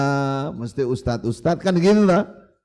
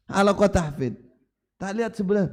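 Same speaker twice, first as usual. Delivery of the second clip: ordinary talk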